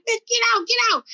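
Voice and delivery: high-pitched, urgent reenacted demand; carjacker's order. Carjacker ordering her out at gunpoint, urgent. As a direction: imitate an agitated gunman giving orders.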